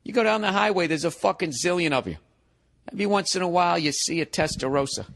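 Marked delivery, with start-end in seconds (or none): none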